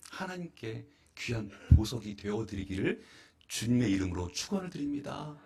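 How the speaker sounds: normal talking voice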